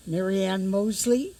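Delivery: ordinary talk, nothing marked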